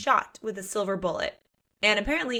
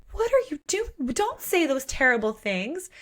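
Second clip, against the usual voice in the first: much higher alarmed plea; mock alarm; 6 semitones higher than usual. [name] stopping Blake's provoking, high mock alarm. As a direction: cry out in shocked protest.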